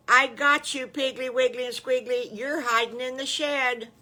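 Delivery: loud, high triumphant call; gleeful shout. Acted. Patty Pig calling out to the hiding pigs, loud and gleeful. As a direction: shout it out like you found them.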